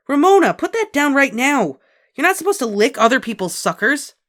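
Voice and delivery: high, loud big-sister telling-off; sharp scolding. A narrator's reading. Big sister orders Ramona to drop the sucker, scolding. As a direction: scold her hard.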